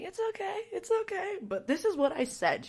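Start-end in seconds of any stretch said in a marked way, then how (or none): 0.0-1.4 much higher and softer, sweet reassuring voice; soothing reassurance. Retold or imitated. The interviewers reassuring her after her rant, high and soft. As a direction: coo it high and soft like comforting someone. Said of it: "And they're like".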